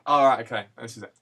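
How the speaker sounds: ordinary delivery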